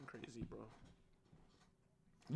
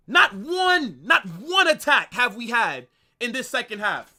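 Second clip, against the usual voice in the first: angry outburst; 3 semitones higher, 7 dB louder than usual. Real, not acted. Streamer yelling at his team's second half, furious. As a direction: let the frustration explode at the team.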